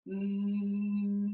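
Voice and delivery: steady hum with closed lips; projected hum with lips closed; humming. Shown as a demonstration. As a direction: hum along with the traffic. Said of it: "start just humming along".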